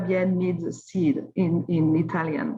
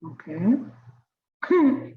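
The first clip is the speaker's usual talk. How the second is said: ordinary talk, nothing marked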